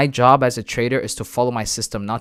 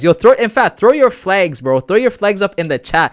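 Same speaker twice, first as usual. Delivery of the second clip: higher, louder rallying chat; hype call; 8 semitones higher, 9 dB louder than usual. Real, not acted. Streamer rallies viewers to post flags, loud hype. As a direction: pump up the chat.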